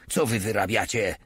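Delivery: plain reading voice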